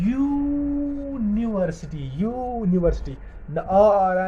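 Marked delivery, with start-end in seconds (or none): 0.1-2.1 यूनिवर्सिटी with 'yoo' held very long and high, then the rest; stretched first sound. Shown as a demonstration. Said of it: "देखो sound क्या आ रही है". 2.2-3.2 यूनिवर्सिटी with a moderately held 'yoo'; stretched first sound. Shown as a demonstration. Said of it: "देखो sound क्या आ रही है".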